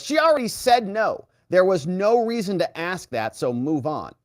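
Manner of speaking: high, emphatic mock telling-off; scolding. Judge telling the lawyer to move on, scolding. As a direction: imitate an impatient judge cutting someone off.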